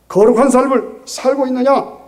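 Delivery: ringing, emphatic challenge; forceful challenge. Real, not acted. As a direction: demand it of the congregation, full voice. Preacher repeats question forcefully.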